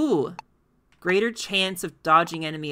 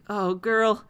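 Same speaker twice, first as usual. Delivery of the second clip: ordinary talk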